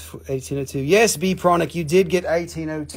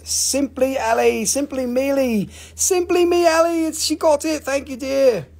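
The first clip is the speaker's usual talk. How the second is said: high, excited sing-out; calling out a winner; 9 semitones higher, 3 dB louder than usual. Real, not acted. Seller announces who got it, high excited call. Announcing the winning claim aloud.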